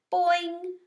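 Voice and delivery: high, loud frog twang; a happy springy boing; pleased boing. A narrator's reading. Frog answering the custodian, pleased boing.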